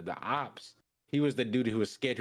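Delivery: usual speaking voice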